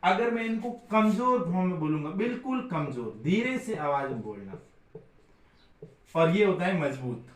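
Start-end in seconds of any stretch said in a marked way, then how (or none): none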